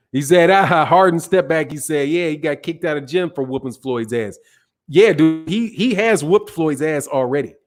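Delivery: usual speaking voice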